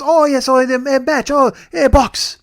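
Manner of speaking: high, loud mock realisation; hammed-up high-pitched fluster; flustered clerk. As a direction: imitate a clerk babbling in sudden understanding. Mimics flustered clerk finally understanding.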